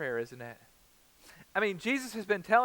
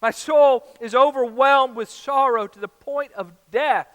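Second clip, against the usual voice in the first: distressed; 8 semitones higher, 10 dB louder than usual. Acted. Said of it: "deeply distressed and troubled".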